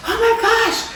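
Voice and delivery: high, loud laughing gasp of surprise. Retold or imitated. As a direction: burst out in delighted disbelief. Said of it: "And I was like".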